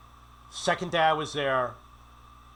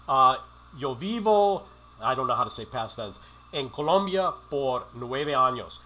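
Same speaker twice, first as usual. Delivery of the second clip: halting Spanish. Retold or imitated. Foreigner showing his broken Spanish to the women, halting. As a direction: stumble through the Spanish.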